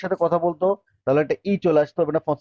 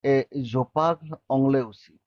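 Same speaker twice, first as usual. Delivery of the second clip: slow, careful French for learners; slow demo; much slower than usual. Shown as a demonstration. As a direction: lay out each word slowly for the class. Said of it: "teacher models French line".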